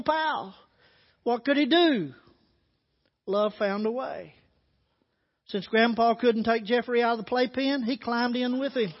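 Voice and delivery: storyteller's reading voice, gently painting the scene; preacher reading a grandpa story to the congregation, told as a story. Acted. Read it like a tender tale. Reads an illustration story aloud.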